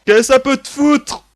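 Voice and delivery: loud, high angry retort; angry shout. Acted. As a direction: yell it back, offended. Narrator snaps back at character.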